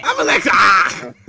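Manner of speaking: loud, slow, high mocking yell; mock shout. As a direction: yell her name out big. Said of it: "that's her official name".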